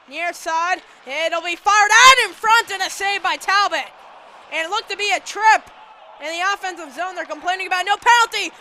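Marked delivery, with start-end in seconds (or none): 1.1-8.5 loud, urgent game call; excited play-by-play. Real, not acted. Announcer calling a save and disputed trip, excited. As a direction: push the volume as the action heats up.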